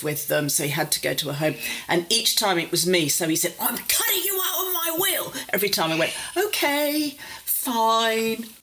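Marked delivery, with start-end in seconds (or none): 3.6-5.3 much higher, huffy mock threat; mock father threat. 6.4-8.5 breezy humouring. Retold or imitated. Humouring father's threat.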